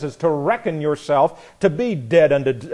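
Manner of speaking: ordinary delivery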